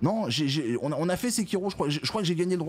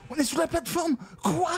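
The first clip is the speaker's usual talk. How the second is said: much higher, near crying in frustration; high panicked whine; 13 semitones higher than usual. Real, not acted. Gamer whines high, stuck under the platform.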